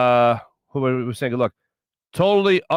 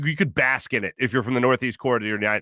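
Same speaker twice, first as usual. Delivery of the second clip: usual speaking voice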